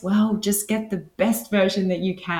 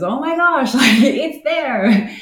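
talking through real laughter, rising higher and louder; laughing; 8 semitones higher, 8 dB louder, slower than usual. Real, not acted. Speaker marvels that natural healing is free, laughing. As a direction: laugh your way through it, voice lifting. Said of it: "I'm just laughing because".

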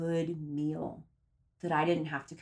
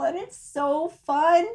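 excited; 8 semitones higher, 6 dB louder, slower than usual. Real, not acted. Excited about puppy sitting.

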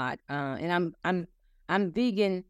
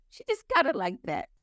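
higher, playful brag; sassy boast; 8 semitones higher than usual. Retold or imitated. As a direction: toss it off with cheeky pride, pitched up. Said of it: "I say".

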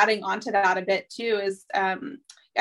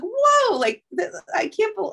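stunned disbelief; 11 semitones higher, slower than usual. Retold or imitated. Mom stunned by son's outburst.

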